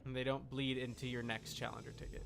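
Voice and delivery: normal talking voice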